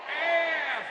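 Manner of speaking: one loud, high barked word; shouted answer. Real, not acted. Speaker to audience, a loud shouted one-word answer. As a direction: belt it out for the crowd.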